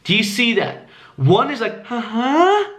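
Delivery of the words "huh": high HUH sliding upward; needy upward HUH, asking for approval; seeking-rapport tone. Shown as a demonstration. Demonstrating poor tonality, needy high rising HUH. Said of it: "makes you seem needy".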